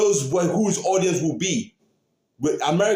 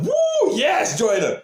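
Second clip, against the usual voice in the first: high whooping cheer for Joyner; excited cheer; 10 semitones higher, much slower than usual. Retold or imitated. Fan recalling cheering on Joyner, whooping high. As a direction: whoop it up like your guy just won.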